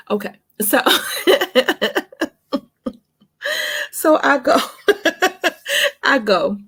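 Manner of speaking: laughing through the words; high giddy laughter. Real, not acted. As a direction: crack up, can't get it out. Laughing fit mid-story.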